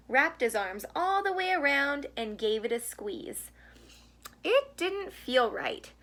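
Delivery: gentle storybook read-aloud; reading to children. A narrator's reading. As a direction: read it like a bedtime picture book. Reads picture book to children.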